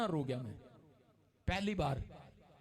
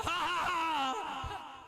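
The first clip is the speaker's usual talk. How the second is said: a longer laugh on command; laughing again; 6 semitones higher than usual. Retold or imitated. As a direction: laugh once more because you were told to. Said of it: "फिर से हंस". (फिर से हंसी)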